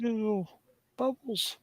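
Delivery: put-on calling the pet in soft baby talk; cutesy baby talk. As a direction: coo the pet's names sweetly.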